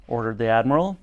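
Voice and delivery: ordinary delivery, nothing marked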